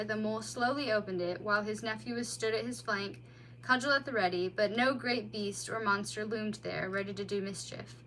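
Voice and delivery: plain reading voice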